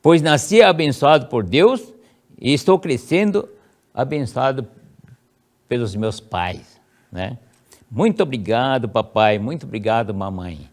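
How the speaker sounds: first-person affirmation. Shown as a demonstration. Child reciting the prayer to self, high and solemn. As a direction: proclaim it to yourself. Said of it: "você vai ler assim".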